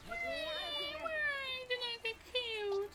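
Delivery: high, slow, drawn-out whine; long high-pitched lament; mock wail. Wailing after being killed in game.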